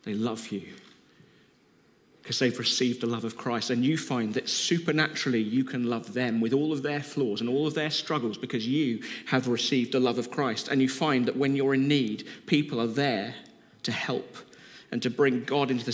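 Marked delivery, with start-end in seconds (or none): none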